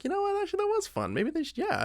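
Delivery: high gushing enthusiasm; excited. Retold or imitated. Gushing mock self after movie.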